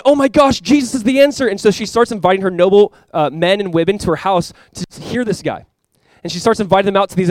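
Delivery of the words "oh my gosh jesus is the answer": high, louder gushing exclamation; imitating a thrilled noblewoman's discovery; excited new convert. Newly saved wealthy noblewoman tells her friends, excited. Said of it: "so excited about the gospel".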